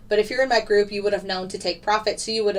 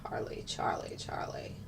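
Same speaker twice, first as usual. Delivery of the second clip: whispered calling; 14 dB quieter than usual. Real, not acted. Whispering a name across the room.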